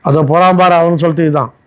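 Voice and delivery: ordinary talk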